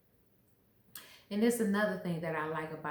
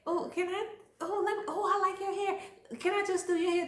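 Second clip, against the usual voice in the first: high-pitched gushing over someone; mock fawning, pitched way up; imitating an eager admirer; 11 semitones higher, 3 dB louder, faster than usual. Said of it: "You be like".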